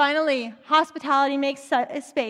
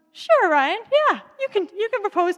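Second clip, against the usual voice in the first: louder, much higher cheerful agreement; enthusiastic yes; 8 semitones higher, 7 dB louder than usual. Retold or imitated. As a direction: throw your arms open, happily saying yes. Bob answers Ryan with enthusiasm.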